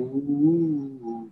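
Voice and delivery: high sung woo ad-lib. Retold or imitated. Imitating [name]'s studio ad-lib, a high woo. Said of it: "[name] added the woo".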